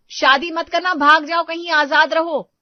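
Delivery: embittered wife loudly warning her friend against marriage; bitter outburst. Acted. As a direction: plead with her to stay free.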